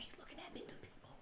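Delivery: fast, hushed whisper; imitating a friend's secretive whisper; urgent whisper. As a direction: whisper it quickly so he won't hear. Friend hiding from man at door.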